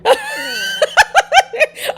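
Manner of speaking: a high, loud burst of real laughter; loud shrieking laugh. Real, not acted. Cracking up as the referee pushes Becky, loud shrieking laugh.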